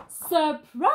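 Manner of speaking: loud, bright surprise cheer; joyful shout. Acted. Villagers surprising the arriving pirates, loud cheer. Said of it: "everyone shouts".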